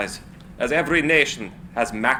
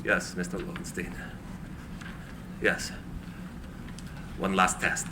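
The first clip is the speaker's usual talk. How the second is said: much lower and softer, barely holding emotion; quietly moved; 8 semitones lower, 12 dB quieter than usual. Acted. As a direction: accept their loyalty in a hushed, moved voice. Tesla moved by staff staying.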